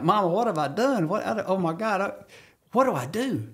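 put-on a grown man sounding like a scared little boy; like a little kid. Retelling the kill, he voices his panicked kid self. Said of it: "I went back like a little kid".

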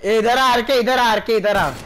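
loud, high repeated summons; urgent call. Real, not acted. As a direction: shout for him to get over here. Calling RK urgently.

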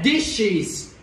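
DISHES drawn out in two clear syllables with a voiced final z; clear plural ending. Shown as a demonstration. Teacher models the plural for the class, DISHES slow and clear. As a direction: say DISHES slowly, ending on a z sound. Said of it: "phía cuối là âm giờ".

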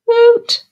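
a sudden high, loud owl hoot; spooky owl call, high and loud. Acted. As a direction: hoot like an owl in the dark night. Night noise scares Henry.

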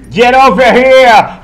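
huge bellowed Get over here; game battle cry. Pretend. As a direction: bellow it like a fighter. Scorpion game shout.

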